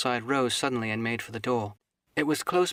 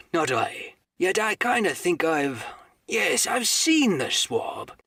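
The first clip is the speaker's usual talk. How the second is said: aloud, musing; 4 semitones higher, 4 dB louder, slower than usual. Acted. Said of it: "he ran on again aloud".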